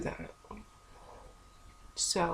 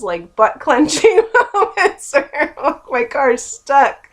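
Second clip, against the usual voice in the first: laughing; 7 semitones higher, 12 dB louder than usual. Real, not acted. Laughs while retelling scary moment.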